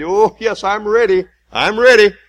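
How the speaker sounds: high, loud, overconfident Peter; preacher mimicking Peter's eager boast; mock eager Peter. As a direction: play Peter insisting he is ready. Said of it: "You said".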